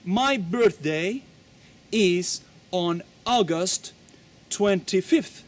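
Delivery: slow, spaced example answer; slow model answer. Shown as a demonstration. Teacher giving a second sample reply. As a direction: give the date slowly and clearly. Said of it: "Mi cumpleaños es el primero de julio".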